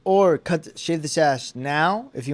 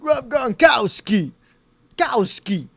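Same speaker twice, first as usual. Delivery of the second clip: put-on Boston accent; 8 semitones higher, much slower than usual. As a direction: belt the name in a Boston accent. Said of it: "how were they saying?".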